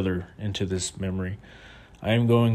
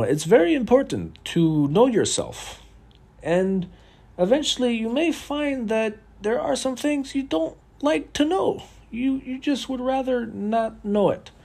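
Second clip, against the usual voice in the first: much higher-pitched voice impression, measured delivery; put-on high voice of a meditation thinker; Yuval Noah Harari; 13 semitones higher than usual. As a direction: imitate a lecturer in a high, thin pitch. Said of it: "That's my impression of Yuval Noah Harari".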